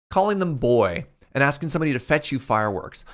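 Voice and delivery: normal talking voice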